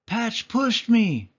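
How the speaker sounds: high, slow little pup voice; small whining puppy; tattling puppy. A narrator's reading. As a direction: tell on your brother like a small pup. Puppy voice for Lucky.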